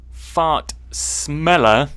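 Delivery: drawn-out "fart smeller", each word apart; deliberate slip. Shown as a demonstration. Showing what the twister is after, slow and deliberate. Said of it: "That's what it's trying to do".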